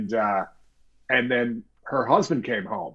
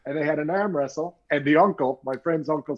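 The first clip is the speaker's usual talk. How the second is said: usual speaking voice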